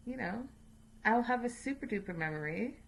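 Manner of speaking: ordinary talk, nothing marked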